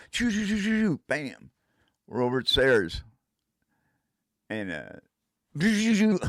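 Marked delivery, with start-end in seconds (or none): 0.1-1.0 high train chugging; choo choo. Retold or imitated. Telling friends the trip skipping ahead, high choo choo. 5.6-6.2 high, loud train sound; choo choo. Retold or imitated. Telling friends another jump in time, loud high choo choo.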